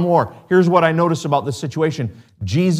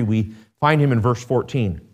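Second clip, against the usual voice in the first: usual speaking voice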